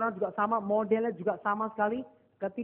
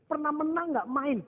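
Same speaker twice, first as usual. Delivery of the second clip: higher, slow, drawn-out probing question; raised-pitch, insistent retold question; eager coach; 5 semitones higher, slower than usual. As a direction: press the athlete hopefully, stretching the words. Coach retries the question, pressing eagerly.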